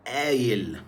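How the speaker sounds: قايل said "ayel", glottal stop for q, y for hamza; Egyptian pronunciation. Shown as a demonstration. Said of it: "في الإيجيبشن أرابيك؟ بنقول". (قايل بالمصري، "آيل")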